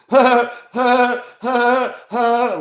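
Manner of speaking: high, loud manic cackle; crazy laughing. Retold or imitated. Buddy high on salvia, laughing like crazy. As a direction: laugh like you've lost your mind. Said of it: "laughing like crazy".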